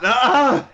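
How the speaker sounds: high, loud cry of dismay; anguished wail. Real, not acted. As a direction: let out a pained high wail. Upset recalling friends stopping.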